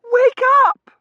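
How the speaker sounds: very high, loud cry; panicked daughter. Acted. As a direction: cry out to rouse her. Daughter rousing fainted mother.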